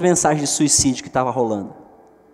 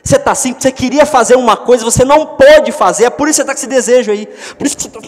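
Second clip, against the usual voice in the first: higher, louder, fast insistent reproach; building scolding; 8 semitones higher, 7 dB louder, faster than usual. Acted. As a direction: press the point harder and harder. (repreensão crescente, alta e rápida)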